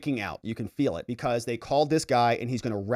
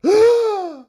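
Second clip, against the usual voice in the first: high, loud shocked gasp; 10 dB louder than usual. Retold or imitated. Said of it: "Gasps all in the courtroom".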